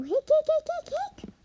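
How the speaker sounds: high, rapid hiccup string; squeaky hiccups. Acted. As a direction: hiccup again and again. Hiccups come back.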